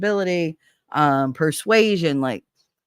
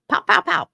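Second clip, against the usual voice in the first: gunshot noises; 14 semitones higher than usual. Retold or imitated. Imitating gunfire, louder and higher.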